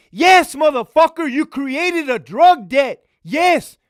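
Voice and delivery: furious lieutenant yelling at an inmate. Retold or imitated. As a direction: blow up at the inmate. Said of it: "The clerks heard me yelling".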